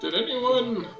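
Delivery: ordinary talk, nothing marked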